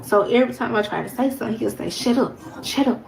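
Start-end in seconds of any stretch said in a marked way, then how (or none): 1.9-3.0 quick higher-pitched mock shut-up; retold angry order. Man cuts her off whenever she speaks, retold anger. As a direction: imitate him cutting in, pitch raised. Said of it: "because he's so angry".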